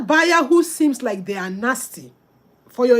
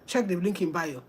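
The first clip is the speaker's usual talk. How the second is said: curt dismissive reply; 6 semitones lower, 9 dB quieter than usual. Retold or imitated. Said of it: "And you're giving them one syllable".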